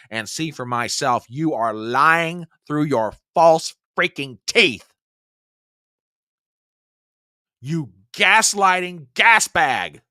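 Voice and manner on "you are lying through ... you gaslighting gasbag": louder, slower, biting each word; furious rant. Real, not acted. Commentator yelling at the president on screen, furious. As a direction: chew him out through the screen.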